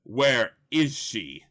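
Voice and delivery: slow, loud villain demand; Mister Freeze. Acted. Villain questions the heroes, loud and drawn-out. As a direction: demand it with every word drawn out. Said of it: "And he says".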